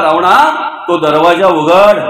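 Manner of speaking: low, slow, far-sounding command; a deep measured sage's order. Acted. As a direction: order him low and slow to open the door. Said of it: "त्याला सांगितलं रावणाला".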